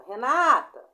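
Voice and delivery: loud call of Renata, stress on "NA"; teacher demonstrating a name shouted to someone; calling a friend. Shown as a demonstration. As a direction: call out Renata with the middle syllable pushed. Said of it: "Chama, você não sabe chamar".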